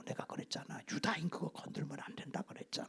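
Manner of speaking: whispered reproach; wife whispering an I-told-you-so to her husband; hushed warning. Acted. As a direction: whisper it urgently, as if someone might overhear. Wife warns husband secretly.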